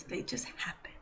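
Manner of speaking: whispered aside. Real, not acted. Coach musing on what just happens, whispering. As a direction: let it fall away to a whisper. Trailing off into breath.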